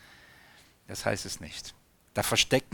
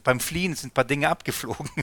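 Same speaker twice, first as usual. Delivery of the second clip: ordinary talk, nothing marked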